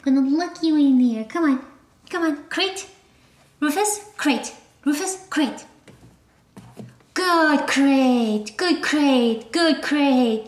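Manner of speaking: high, sing-song talk to a puppy. Real, not acted. Reassuring the puppy in his crate, high baby talk.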